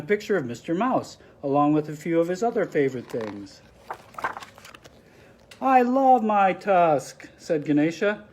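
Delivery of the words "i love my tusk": delighted. Acted. Ganesha delighted with tusk.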